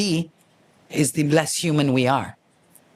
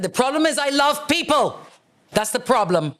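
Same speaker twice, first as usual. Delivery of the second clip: exasperated high retort; 10 semitones higher than usual. Retold or imitated. Retorting to check-in agent.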